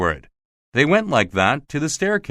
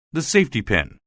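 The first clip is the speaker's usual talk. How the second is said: usual speaking voice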